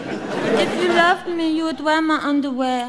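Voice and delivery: pouting French guilt-trip; French accent. Acted. Claudette guilt-trips Stuart into wearing underwear, pouting French accent. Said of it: "in a French accent".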